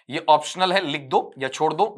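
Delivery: normal talking voice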